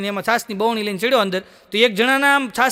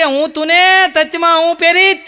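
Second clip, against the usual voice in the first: put-on high, lisping baby talk, louder; lisping childish voice; 3 semitones higher, 3 dB louder than usual. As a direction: babble like a toddler comforting granny. Vitthal lisping baby talk again.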